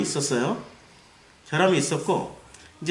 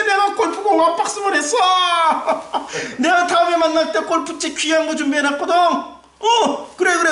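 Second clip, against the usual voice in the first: high, loud hammed-up congratulations with laughter; Eli; 12 semitones higher, 4 dB louder than usual. Eli congratulating an elder on his hole-in-one, effusive schmoozing. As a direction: flatter them with gushing mock excitement. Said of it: "엘리가 전화를 하는 거야".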